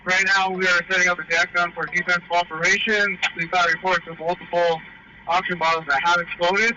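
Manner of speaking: loud, high shout through a mask; yelling over the fire into the radio; firefighter shouting through mask. Real, not acted.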